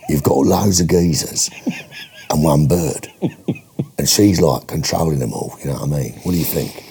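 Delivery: low-pitched, matey Cockney pitch; Cockney film director. Acted. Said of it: "Can you do your Cockney film director?".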